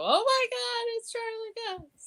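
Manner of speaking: very high excited squeal, slowing at the name; starstruck squeal. Retold or imitated. Hearing Charlie Cox's voice, in a high starstruck squeal. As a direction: gush like a fan who just heard him. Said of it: "like, go".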